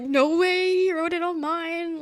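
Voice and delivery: high, loud excited squeal of words; thrilled disbelief. Retold or imitated. Fan retelling seeing the line written on the autograph, thrilled disbelief.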